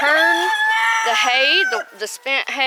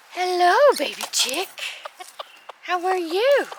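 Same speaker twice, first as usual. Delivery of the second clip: higher cooing greeting; 8 semitones higher than usual. Real, not acted. Greeting the baby chick, high coo.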